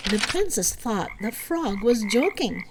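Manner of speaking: plain reading voice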